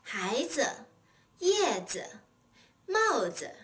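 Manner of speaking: 孩子, 叶子, 帽子 with a full first syllable and short, light 子; careful model of 孩子, 叶子, 帽子, 子 left unstressed; neutral-tone 子. Shown as a demonstration. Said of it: "the syllable 子 always bears a neutral tone".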